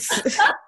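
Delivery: loud, high, airless burst of laughter; breathy laugh burst. Real, not acted. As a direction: explode into a laugh. Laughing at the price.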